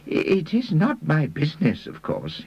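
high, hesitant apology dropping away at the end; apologetic. A narrator's reading. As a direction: begin high with a small stammer, apologetically. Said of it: "he said apologetically".

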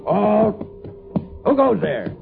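startled challenge. Acted. Sentry challenges a stranger at night.

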